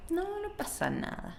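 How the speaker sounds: tiny, high, very soft fake reassurance; passive aggressive. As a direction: brush it off sweetly, high and barely audible. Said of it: "Just that passive aggressive".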